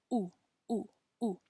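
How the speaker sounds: "U" as a pure oo with no y sound in front; pure Spanish u. Shown as a demonstration. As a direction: round the lips and say only the oo of "u". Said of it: "we're just gonna do that ending sound".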